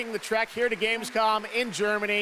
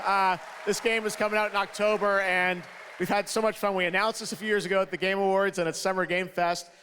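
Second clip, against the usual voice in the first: normal talking voice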